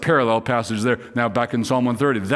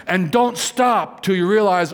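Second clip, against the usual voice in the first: ordinary talk, nothing marked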